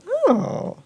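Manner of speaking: high campy creature voice, mock sweet; sassy Adder creature. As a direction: play a smug gossiping creature spilling a secret. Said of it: "And the Adder's like".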